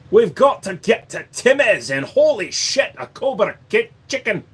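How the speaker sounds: comic Scottish exclamation; Scottish accent. Retold or imitated. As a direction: blurt it in a hammed-up Scottish voice.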